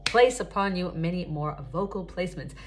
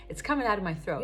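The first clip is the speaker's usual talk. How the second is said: normal talking voice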